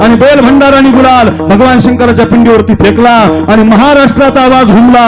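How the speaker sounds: high-pitched, full-force preaching; rally the men with ringing repeated cries; fervent battle oratory. Acted.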